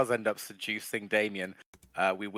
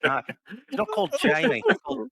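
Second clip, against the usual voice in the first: put-on New Zealand accent; 5 semitones higher, 4 dB louder than usual. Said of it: "get into the New Zealand accent".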